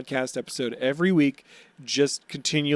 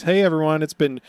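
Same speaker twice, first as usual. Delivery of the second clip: loud, slow grand introduction; hype announcer; 5 semitones higher, 6 dB louder, slower than usual. Acted. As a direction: announce him like a ringmaster. Announces Buggy Watch.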